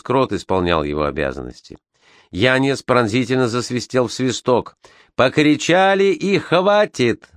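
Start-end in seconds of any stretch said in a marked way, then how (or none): 5.1-7.3 barked. Acted. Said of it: "гаркнул он".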